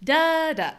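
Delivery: first da high and loud, second dropped; da da with the weight up front; stress pattern da-DA. Shown as a demonstration. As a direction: hit the first da, let the second fall. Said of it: "a stress pattern".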